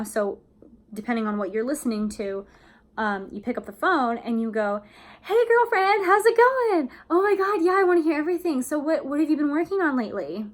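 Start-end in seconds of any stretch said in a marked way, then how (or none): 5.2-10.5 high, loud, fast gushing; excited greeting. Shown as a demonstration. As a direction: squeal hello to a best friend. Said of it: "switch it around now".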